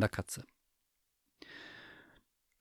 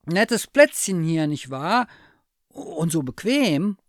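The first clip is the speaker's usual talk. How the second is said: higher, louder, slow forced-casual bear voice; a bear faking ease so the cat won't notice he's stuck; frozen teddy bear; 8 semitones higher, 7 dB louder than usual. Acted. Frozen bear chatting to the cat, high forced cheer. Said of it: "und sagte".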